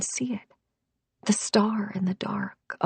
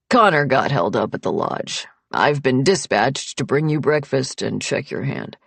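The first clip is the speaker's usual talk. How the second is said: lowered man's voice, businesslike; Nick, matter-of-fact doctor; 6 semitones lower than usual. A narrator's reading. Nick explaining he was sent to check her hand, low and matter-of-fact. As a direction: voice a doctor explaining his errand in a low register.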